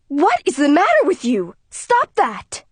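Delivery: mother scolding the daughter smashing plates; mother yelling. Acted. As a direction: yell at her to stop. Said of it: "Mother yells".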